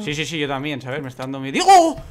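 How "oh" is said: sudden high yelp of alarm; startled shout. Real, not acted. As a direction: jump out of your skin. Sudden attack in game.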